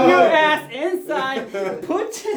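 loud, high, drawn-out retold yell; hammed-up furious order; retold angry outburst. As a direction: act out losing it at a neighbor. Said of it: "And I just fucking switched".

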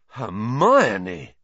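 drawn-out amazed exclamation, falling at the end; stunned and impressed. A narrator's reading. Ron marvelling at Hermione's outburst, stunned and impressed. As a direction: say her name in awed disbelief. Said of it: "sounding both stunned and impressed".

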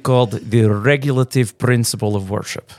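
put-on Russian accent; playful, hammed-up Russian accent. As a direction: say it as a mock Russian. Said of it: "I did it in a Russian accent".

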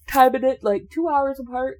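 talking through a yawn; words stretched by a big yawn. Real, not acted. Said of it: "Excuse me for yawning".

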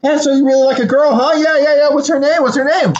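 high-pitched mock nosiness; excited retold questioning. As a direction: act out an over-keen friend prying. Acted agitated character grilling his friend.

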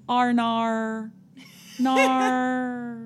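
mock Australian, stretch arnar nar; Australian accent. Shown as a demonstration. Host showing her only Australian line, mock Australian. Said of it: "The only thing I can say is".

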